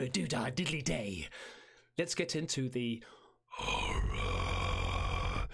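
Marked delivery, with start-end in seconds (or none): 3.5-5.5 very deep demonic growl; horror-game demon voice announcing the game; monster voice. Acted. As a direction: drop into a demon's voice.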